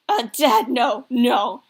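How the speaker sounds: loud, emphatic embarrassed protest; acted horrified refusal. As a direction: cut Dad off, loudly mortified. Son refuses offer of condoms.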